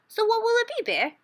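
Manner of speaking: high, quick, teasing offer; sly rabbit pressing the sleepy bear; eager Hare. A narrator's reading. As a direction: dangle the choice in front of him. Hare's voice in story.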